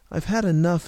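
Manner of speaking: loud, high desperate outburst; urgent and worked up; gasped. A narrator's reading. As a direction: gasp it out in desperation. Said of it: "he gasped".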